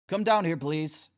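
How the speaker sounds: loud high call up the stairs. Acted. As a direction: call it up firmly then soften. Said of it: "Doctor Hagen calls up".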